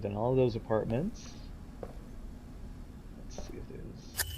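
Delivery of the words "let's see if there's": hushed, breathy whisper. Real, not acted. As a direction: whisper so nobody hears. Sneaking around the apartments.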